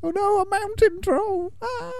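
high, softly squealed mock terror; hammed-up distress in a small, high voice; mock panicked reading. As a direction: read it as a tiny strangled cry for help. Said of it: "Gandalf's reading out the book".

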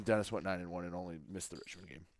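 fast, quiet mumble under the breath. Real, not acted. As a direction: rush it out, hoping nobody hears. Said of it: "What was that?".

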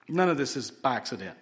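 normal talking voice

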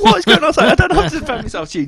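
very high, laughing and fast; flustered disbelief. Retold or imitated. Narrator defending himself to the woman, high flustered laugh. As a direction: splutter helplessly, laughing. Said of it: "And I was like".

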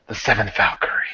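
breathy, half-whispered cool reveal; real cool. Shown as a demonstration. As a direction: let the name out in a cool hush. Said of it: "real cool".

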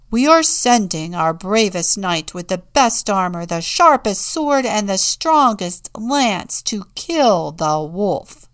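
loud, emphatic rallying announcement; bold proclamation. A narrator's reading. Town leaders sending a knight, loudly proclaimed. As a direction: boast it out to the whole town.

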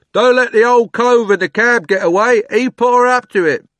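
high, loud yell from the crowd; calling out. A narrator's reading. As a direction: shout across the crowd. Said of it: "someone else called out".

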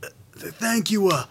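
a quiet, mumbled imitation of Dad's halting thank-yous; impression of his father. Son mocks his newly found father's way of talking, in a quiet, halting mumble. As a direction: mumble it softly, stumbling on the words like the old man. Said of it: "talk like him? Just go".

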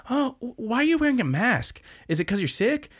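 high, cutesy airhead voice; distracted, curious girl. Hado jumps in front of Shoji, high curious chirp. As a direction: imitate a girl firing off curious questions. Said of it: "she gets very distracted and goes on a series of questions".

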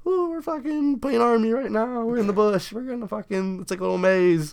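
high, sing-song imitation of playing kids; playful kids. Kids playing army in the bush, mock-gleeful. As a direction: put on a high, gleeful little-kid voice.

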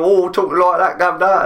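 high, imitated Cockney patter; mock Cockney accent. Retelling an American pen pal's attempt, mock Cockney accent. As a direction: put on a hammed-up Cockney voice. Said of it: "you know, Cockney accent".

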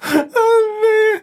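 squeaky helpless giggle; high squealing laugh. Real, not acted. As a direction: lose it in a squeal. Laughing fit again.

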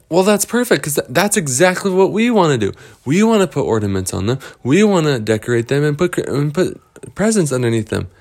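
high, fast, excited chatter; eager kids. Acted. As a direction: rush out the delight. Lost Boys thrilled by tradition.

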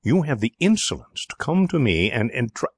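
too outraged to finish the sentence; wrathful. A narrator's reading. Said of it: "his wrath prevailing".

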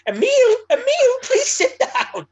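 high, loud camp shriek; camp teacher. Retold or imitated. French teacher calling out a pupil's false name, camp and high. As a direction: mimic a very camp teacher pleading, high-pitched. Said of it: "We had one very camp guy".

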